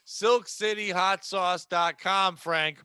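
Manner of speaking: slow, deliberate word-by-word prompt; spoon-feed each word; pointedly slow. Real, not acted. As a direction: spell it out for a slow friend. Spoon-feeds Frank the forgotten website.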